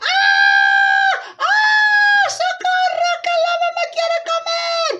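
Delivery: put-on very high, loud girlish shriek; screaming for help. Girl attacked by the wolf, shrieking for help. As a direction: scream in hammed-up terror. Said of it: "Caperucita de gritar".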